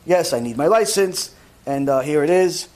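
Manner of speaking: low, even transactional voice with hesitant filler; demonstrating polite, impersonal small talk in a low monotone; cordial but detached. Shown as a demonstration. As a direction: talk with eyes down, low and unengaged. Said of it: "and that's how you talk".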